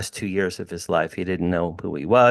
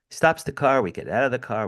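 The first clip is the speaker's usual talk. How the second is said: ordinary delivery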